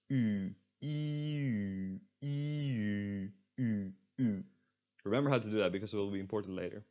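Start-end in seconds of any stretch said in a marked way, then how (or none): 0.1-4.4 "E" gliding into rounded "U", long and held; rounding ee into u. Shown as a demonstration. Teacher demonstrates the trick, held "E" sliding into "U". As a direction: sustain "E", round the lips to "U". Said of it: "and around the lips".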